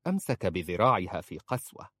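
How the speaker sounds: plain reading voice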